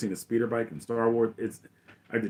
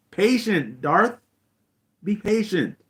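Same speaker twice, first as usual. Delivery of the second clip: lightly voiced Emperor, higher pitch; patient Emperor; 8 semitones higher, slower than usual. Emperor counsels Darth like Yoda, patient mentor. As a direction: counsel an apprentice, lightly acted. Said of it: "the Emperor is almost like Yoda".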